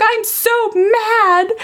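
put-on high, drawn-out little-kid whine; upset small child. Small daughter answering her mother, high drawn-out whine. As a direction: imitate a three-year-old mid-tantrum. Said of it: "she was just like".

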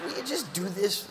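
ordinary talk, nothing marked

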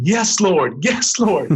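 high-pitched jubilant shout; screaming. Retold or imitated. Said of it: "I just kept screaming".

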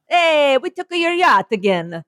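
hammed-up Italian accent with a lifted "Hey"; comic Italian announcing another grab; mock Italian accent. Voicing the Italians seizing yachts.

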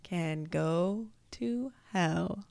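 slow, drawn-out toast. Shown as a demonstration. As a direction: stretch every word slowly. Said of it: "read my one sentence very slowly".

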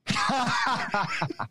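sudden high laugh; high burst of laughter. Real, not acted. Host cracks up at the double meaning, laughing.

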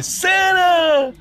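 high, loud delighted cry of Santa; joyful shout. Retold or imitated. Mother greeting Santa on the phone, high joyful shout. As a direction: shout his name with joy.

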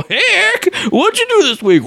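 very high, silly squeaky impression; hammed-up falsetto greeting to a co-host; mock co-host voice. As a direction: put on a squealing show-host parody. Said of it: "there would be an awesome Eric impression and an awesome Danny impression".

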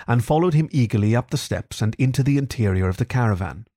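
plain reading voice

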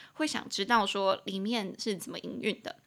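ordinary talk

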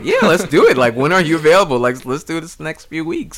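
keen, quick eager reply. Retold or imitated. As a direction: jump at the chance, keen and fast. Own eager reply retold.

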